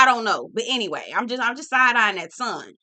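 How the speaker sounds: ordinary talk, nothing marked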